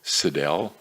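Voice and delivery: plain reading voice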